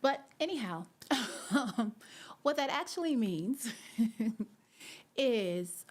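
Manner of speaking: high, loud, laughing recovery; laughing, embarrassed. Real, not acted. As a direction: push on through the giggles. Embarrassed laughter after horrible French attempt.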